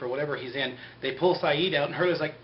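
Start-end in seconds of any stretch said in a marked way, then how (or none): none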